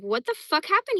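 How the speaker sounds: lightly voiced alarmed dog; dog's imagined voice. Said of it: "and he's like".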